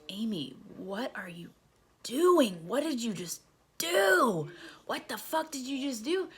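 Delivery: high, swooping disbelief; exaggerated appalled questioning; self-scolding. Scolding herself amid the mess.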